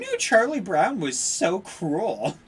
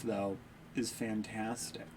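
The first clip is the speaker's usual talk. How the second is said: usual speaking voice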